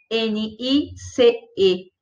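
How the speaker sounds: N I C E spelled slowly, letter by letter; spelling it out. Shown as a demonstration. Teacher spells nice for students, slow and deliberate. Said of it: "ela é escrita da seguinte forma".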